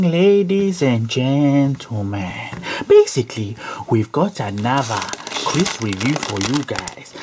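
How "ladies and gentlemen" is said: high, slow, loud drawn-out hello; showman greeting. Pretend. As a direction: belt it like a game show host. Hammed-up TV show greeting.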